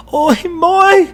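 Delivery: shrill high anguished cry; a child's sudden scream of terror from inside the house. A narrator's reading. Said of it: "the first child screams".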